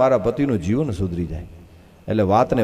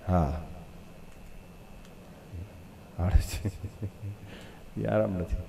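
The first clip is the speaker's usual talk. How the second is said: quiet repeat; 3 semitones lower, 14 dB quieter than usual. Shown as a demonstration. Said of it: "યાદ રાખજો".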